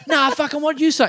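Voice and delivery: high-pitched fighting challenge; mock belligerent shout. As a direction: keep arguing while being dragged off. Man dragged away, still arguing.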